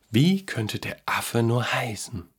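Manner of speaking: slow, soft musing; pondering. A narrator's reading. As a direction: think it over slowly and quietly. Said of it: "überlegte der Junge". (nachdenklich grübelnd)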